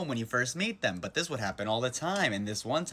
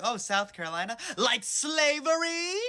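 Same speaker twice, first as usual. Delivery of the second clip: much higher, slow, drawn-out squeal; mock gushing girl; 12 semitones higher, slower than usual. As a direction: turn their cliché back on them. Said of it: "I'm like".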